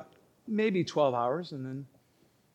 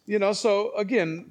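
ordinary talk

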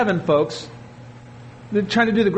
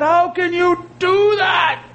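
mock outraged shout; incredulous outcry; 10 semitones higher, slower than usual. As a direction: cry out in disbelief. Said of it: "And people said".

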